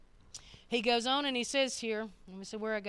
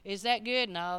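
ordinary delivery

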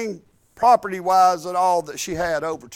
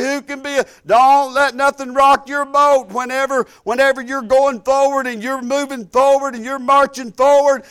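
louder, rousing preacher's cadence; fiery preaching; 3 semitones higher, 3 dB louder than usual. Acted. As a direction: preach it to the congregation with fire. Preaching faith at full force.

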